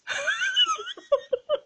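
a high laugh that falls away; burst of high laughter. Real, not acted. Laughing at the Dutch way of telling time. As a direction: laugh out at how odd it is.

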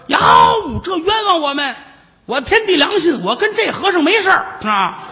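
hammed-up high wail, pleading she's been wronged; wailing protest. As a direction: cry foul like a shrew throwing a tantrum. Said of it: "跟这儿撒泼打滚儿，眼泪哗哗的".

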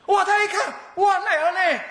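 high, loud amazed cry with a laugh; delighted. Acted. Old man finds himself suddenly ordained, thrilled. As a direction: burst out in giddy wonder.

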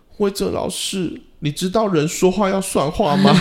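put-on a wounded little boy reproaching his teacher; hurt child. Student accuses the teacher of breaking his word, high hurt child voice. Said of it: "他突然很难过说".

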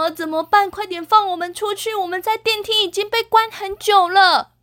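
high whiny panic; trapped and scared. Acted. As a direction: plead from inside the lift. 被困的人求救. (害怕地哀求)

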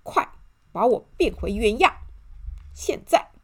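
loud, angry order; angry demand. A narrator's reading. Shrunken Mr. Arrogant demands to be changed back, angry. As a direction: bark it out furiously. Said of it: "他生气地说".

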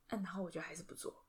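whisper. Real, not acted. Confessing she still won't do chores.

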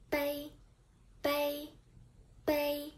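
"bei" held high and level; first tone bei. Shown as a demonstration. Teacher modelling the first tone word "bei" for learners. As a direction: keep "bei" on one high steady pitch. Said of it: "Repeat after me for two times".

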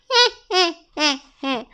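sneaky villain snicker; a little evil chuckle; sly snicker. A narrator's reading. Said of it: "恶霸龙说着". (恶霸龙奸笑)

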